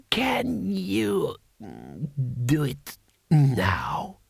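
put-on slow, halting old ruler; drawn-out, feeble imperial voice; ancient emperor. Emperor asking Rincewind for a trick, slow ancient voice.

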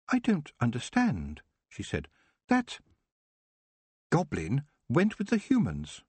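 a hint of a baffled elf; puzzled elf. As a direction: elf questioning what she saw. Nightshade puzzled.

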